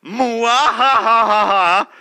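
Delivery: slow, loud villain laugh; evil cackle. Acted.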